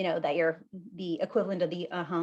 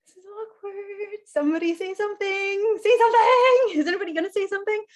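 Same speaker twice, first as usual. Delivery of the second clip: high, pleading for a reply; host demonstrating an awkward silence, high and pleading; mock awkward plea; 11 semitones higher, 4 dB louder than usual. Shown as a demonstration. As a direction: beg the silent room up high. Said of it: "demonstrating awkward silence".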